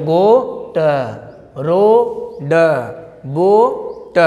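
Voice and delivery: गोट, रोड, बोट each split as long "o" then final consonant; sounded out. Shown as a demonstration. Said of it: "ए ओ क्या पढ़ रहे हैं".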